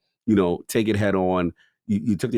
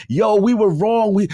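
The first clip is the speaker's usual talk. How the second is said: high, loud mock media shout; hyped announcement; 10 semitones higher, 5 dB louder than usual. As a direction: announce the exoneration like breaking news. Acted media retraction, hyped.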